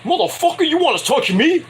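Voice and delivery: high-pitched mock indignation, squaring up; a hammed-up heel demanding are you going to touch me; indignant high challenge. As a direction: play Stokely taking offense, pitch jumping up. Stokely threatens Schiavone, acted.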